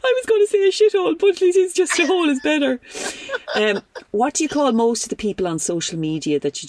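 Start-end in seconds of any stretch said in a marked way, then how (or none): 0.0-2.8 high, giggly approval; laughing delight. Real, not acted. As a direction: laugh through the praise. Host laughing at the answer.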